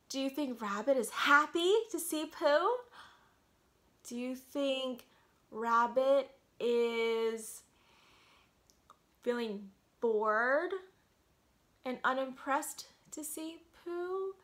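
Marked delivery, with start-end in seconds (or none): none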